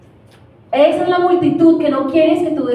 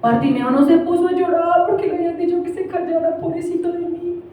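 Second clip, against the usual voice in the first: higher-pitched imitated child's voice; Gabito; 5 semitones higher than usual. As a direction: answer mamá like a small child, pitched up. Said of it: "No, mami". (voz aguda de niño imitado)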